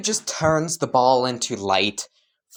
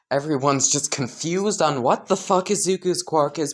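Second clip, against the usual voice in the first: plain reading voice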